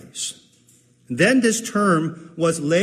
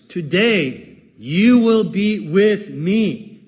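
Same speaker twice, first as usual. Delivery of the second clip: Today you will be with me, slow and louder; emphatic slow quote; 3 semitones higher, 5 dB louder, much slower than usual. Shown as a demonstration. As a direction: draw out every word, with me weighted. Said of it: "That should be the emphasis".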